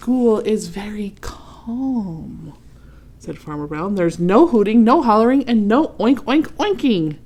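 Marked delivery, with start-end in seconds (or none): 0.1-2.5 slow, hushed whisper; calm whisper. Acted. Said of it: "Hmm, let's see". 3.9-7.2 louder, firm list of rules; stern farmer rules. Acted. Farmer lays down strict rules.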